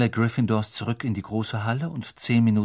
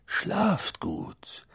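higher and much softer; a tender goodnight to the students; gentle goodnight; 8 semitones higher, 6 dB quieter than usual. Acted. Dumbledore bids goodnight.

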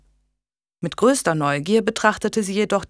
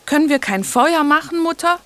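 put-on high, whiny little girl begging; pestering child; 9 semitones higher, 4 dB louder than usual. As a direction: plead like a cold, whining child. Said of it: "quengelte die kleine Caitlin".

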